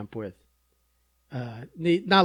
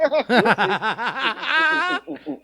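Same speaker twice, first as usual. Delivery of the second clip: laughing; 7 semitones higher, 4 dB louder, slower than usual. Real, not acted. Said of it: "laughing at son".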